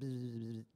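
low, soft buzzing noise; imitated vibration buzz. Device vibrates in the patient's hand, imitated buzz. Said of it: "the thing kinda goes".

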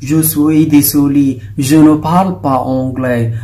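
slow, deliberate French reply; a hint of a foreigner answering slowly. Shown as a demonstration. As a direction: speak the French slowly and clearly. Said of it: "nagsasalita na siya".

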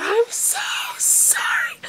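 drawn-out, whispered apology; slow, airy whisper of regret. Real, not acted. Vlogger apologizing to fan whose letter was lost, whispered.

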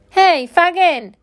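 high, loud, drawn-out call; yelling. A narrator's reading. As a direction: yell to get his attention. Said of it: "yelled Dodger".

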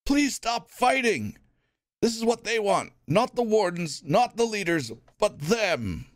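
rally them against the real enemy; urgent Elvin. Acted. Elvin urging the crowd, urgent plea.

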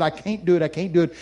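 ordinary talk

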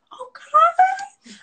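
very high, loud squeal; sudden shriek of excitement; excited outburst. Real, not acted. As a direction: burst out in a piercing squeal. Excited outburst at starting tea time.

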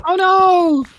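high, slow squealing oh no; mock panic. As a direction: ham up the alarm in a high voice. Mock alarm.

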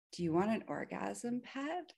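softly voiced, calm question; really calm. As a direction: ask it gently and coolly, as the master. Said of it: "Just really calmly".

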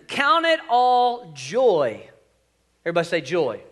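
ordinary delivery

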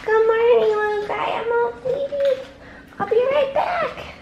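high cooing voice for the dog; pet baby talk. Acted. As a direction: coo to the puppy, voice up high. Greeting her little dog.

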